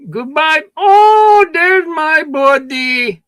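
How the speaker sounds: high, slow, sugary greeting; mock fake-friendly clerk. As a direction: ham up the insincere shopkeeper. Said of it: "their nice way of flipping you off".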